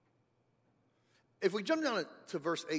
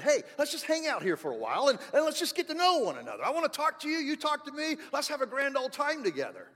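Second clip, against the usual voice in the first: a lightly voiced friendly God, higher; voicing God casually; 9 semitones higher, 6 dB louder than usual. Pastor voicing God inviting his people, friendly and high. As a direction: play God inviting them to hang out. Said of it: "It's kinda like God said".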